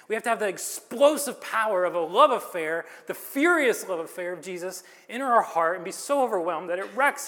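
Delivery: fervent preacher's crescendo; preaching at full force. Acted. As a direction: drive it home to the congregation. Preaching climax.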